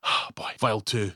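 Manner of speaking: ordinary delivery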